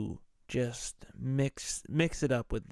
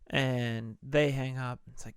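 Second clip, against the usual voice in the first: ordinary delivery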